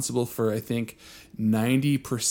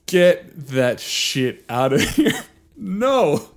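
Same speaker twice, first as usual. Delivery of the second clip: laughing disbelief; 2 semitones higher, 5 dB louder, slower than usual. Real, not acted. Laughing at udder joke.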